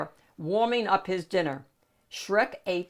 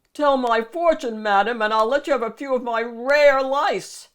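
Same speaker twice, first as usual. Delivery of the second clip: louder, pushy ogre voice; loud gruff bargaining; ogre demanding; 4 semitones higher, 7 dB louder than usual. Acted. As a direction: bargain with the witch boldly. Ogre addressing the witch.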